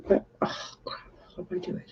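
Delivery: very quiet, near-whispered aside; muttering to self. Real, not acted. Vlogger fumbling with a task, muttering to self. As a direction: mutter it under your breath, barely voiced.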